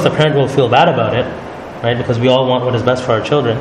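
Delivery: usual speaking voice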